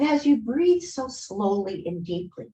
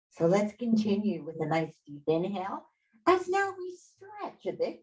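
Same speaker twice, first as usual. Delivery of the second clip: guided breathing; 4 semitones higher than usual. Acted. Yoga teacher guiding the class through a three-part breath, soothing.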